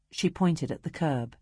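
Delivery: ordinary delivery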